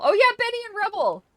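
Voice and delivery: high, loud excited exclamation; joyful realisation of the names; delighted recognition. Real, not acted. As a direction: burst out with glee. Repeating name with sudden recognition.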